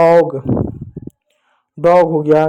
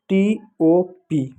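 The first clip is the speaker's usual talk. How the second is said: slow spelling out; 4 dB quieter, much slower than usual. Shown as a demonstration. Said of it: "जैसे एक शब्द है".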